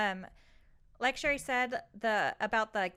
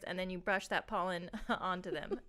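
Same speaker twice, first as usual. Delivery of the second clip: usual speaking voice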